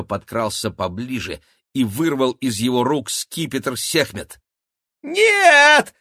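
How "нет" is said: very high loud squeal; yelling. A narrator's reading. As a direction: shriek it out. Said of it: "завопил крыс".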